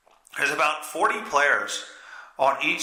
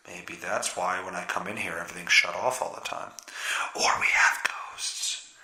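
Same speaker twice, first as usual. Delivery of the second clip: whispered, half-joking spooky aside; playful whisper; 9 semitones lower, 5 dB quieter than usual. Pretend. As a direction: breathe the ghost line like a secret. Spooky aside about dead outlet.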